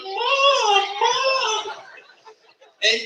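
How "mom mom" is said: whiny child's cry for his mother, played for laughs; imitating a crying kid. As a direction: wail it like a kid stuck and hurting. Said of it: "he started going".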